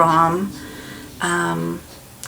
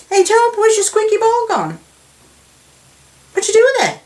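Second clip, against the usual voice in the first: high, louder sing-song teasing; teasing the dog; 6 dB louder, faster than usual. Real, not acted. Owner teases her dog about his broken squeaky ball, high sing-song.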